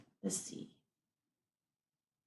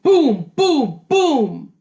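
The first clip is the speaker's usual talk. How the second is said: booming; 4 semitones higher, 6 dB louder, much slower than usual. A narrator's reading. Reading loud booms.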